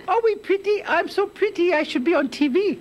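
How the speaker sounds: very high-pitched little puppet character. Pretend. Puppet answering the studio's greeting, high puppet voice. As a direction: squeak it out like a vain puppet.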